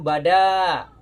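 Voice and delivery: loud "bada" with only the "da" held long; correct reading. Shown as a demonstration. Model reading for students, the long "da" in "bada". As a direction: stretch the "da" in "bada" clearly. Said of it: "ini yang panjang adalah danya".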